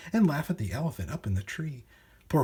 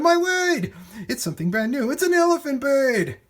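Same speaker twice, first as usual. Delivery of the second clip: very high, loud cries of wonder; amazed shout; 12 semitones higher, 7 dB louder than usual. A narrator's reading. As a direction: cry out in sheer astonishment. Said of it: "they shouted".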